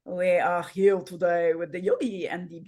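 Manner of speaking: put-on French accent, pitched low. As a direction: slip playfully into a mock French accent. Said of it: "French accent".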